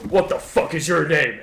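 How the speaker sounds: menacing guy. Retold or imitated. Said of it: "he's like".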